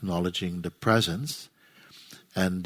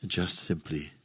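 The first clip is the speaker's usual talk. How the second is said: low, soft voice with long silences between phrases; hushed, spacious meditation leading; guided meditation; 3 semitones lower, 6 dB quieter than usual. Real, not acted. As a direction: guide them gently into stillness, phrase by phrase. Leads a meditation.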